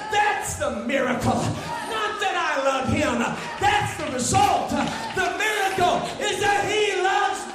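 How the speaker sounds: rising shouted declaration; preaching at full force. Acted. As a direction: punch the miracle home. Shouted punchline.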